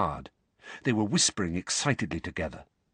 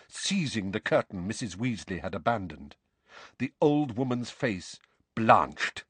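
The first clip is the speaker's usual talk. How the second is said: ordinary delivery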